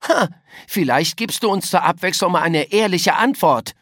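high, loud, annoyed demand; indignant schoolboy challenge; sour outburst. A narrator's reading. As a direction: call the culprit out crossly. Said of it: "rief Philipp sauer".

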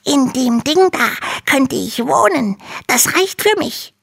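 very high, small dragon voice; little dragon voice. Acted. Little dragon complains to Hanno in a tiny high voice. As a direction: sound like a tiny, timid baby dragon.

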